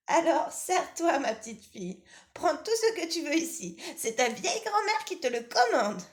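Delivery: high, loud impatient granny voice; old grandmother. Acted. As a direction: nag like a grumpy old granny.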